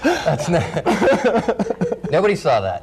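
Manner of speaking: laughing. Real, not acted. Anchors crack up at blooper.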